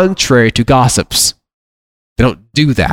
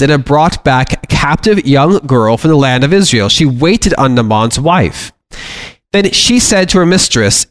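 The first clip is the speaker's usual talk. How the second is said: measured, slightly raised Bible-reading voice; preacher reading scripture aloud from the pulpit; 5 semitones higher than usual. Acted. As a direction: read the passage to the congregation with weight. Reads Naaman scripture passage aloud.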